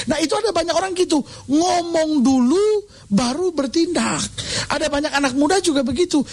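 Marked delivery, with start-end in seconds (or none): 1.4-4.2 each word drawn out slowly; a slow, weighty repeat of the point; slow, stretched emphasis. Acted. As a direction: lean on it, slow and deliberate.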